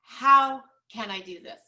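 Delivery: loud, firm and resolute; determined declaration. Shown as a demonstration. Coach urging agents to replace doubt, loud and determined. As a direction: proclaim it like a new motto. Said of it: "cross that off and write down".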